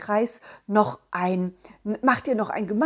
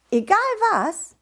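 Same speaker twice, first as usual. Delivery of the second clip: put-on child voice; 11 semitones higher, 4 dB louder than usual. Child asks, high.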